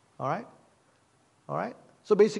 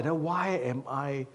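slow self-questioning; 3 semitones lower, 4 dB quieter, slower than usual. Retold or imitated. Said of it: "I located it why".

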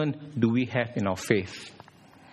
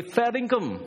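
Fair dinkum in a higher mock Australian; mock Aussie; 8 semitones higher than usual. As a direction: toss off the Aussie phrase. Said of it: "Then they'll say".

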